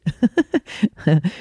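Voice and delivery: high, light giggle at herself; real tickled laugh; amused laugh. Real, not acted. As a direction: laugh it off with delight. Laughs at her own joke.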